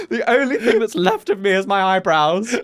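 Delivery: laughing through a mock cry; hammed-up lament while cracking up. Continuing dying bit, laughing.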